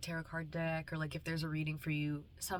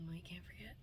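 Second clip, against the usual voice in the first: whispering; 12 dB quieter than usual. Real, not acted. Emotional aside while near tears.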